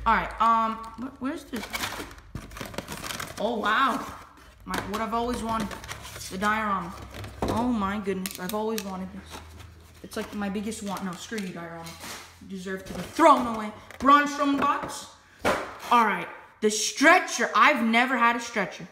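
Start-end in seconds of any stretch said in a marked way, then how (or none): none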